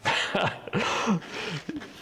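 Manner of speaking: high breathy laugh; airy laugh. Real, not acted. Laughing at his own orc joke, high and airy.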